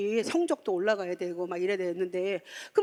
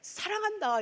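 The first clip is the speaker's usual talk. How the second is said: God's loving voice; 10 semitones higher than usual. Retold or imitated. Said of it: "이렇게 얘기하는 거예요".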